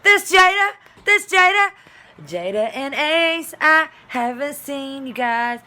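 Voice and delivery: very high and loud, slow; excited shout-out. Real, not acted. Aunt giving her nieces a shout-out, excited. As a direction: call out to them with joy.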